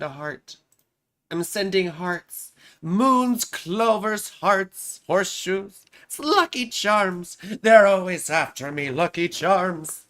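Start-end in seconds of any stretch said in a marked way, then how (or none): 2.8-10.0 bad, hammed-up Lucky Charms leprechaun accent; terrible mascot accent. Streamer joking to amuse herself, terrible leprechaun accent. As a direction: ham up a cereal mascot's Irish accent, badly. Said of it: "That's a terrible accent".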